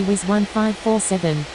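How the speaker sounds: ordinary delivery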